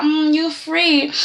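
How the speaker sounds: slow, drawn-out sly Chloe; slow teasing voice of Chloe; Chloe's voice. Retold or imitated. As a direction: purr it out like a knowing Chloe. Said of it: "Chloe, who's like".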